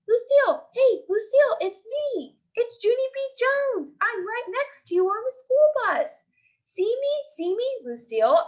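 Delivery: bang on the window and shout to her friend; excited calling out. Acted. Junie yelling from the bus window to Lucille, excited. Junie calls to passing car.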